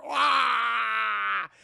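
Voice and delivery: loud, high drawn-out wail; imitated crying wail. Zod heard crying from afar, loud wailing. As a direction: bellow a long mock cry. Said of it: "He's crying and shit".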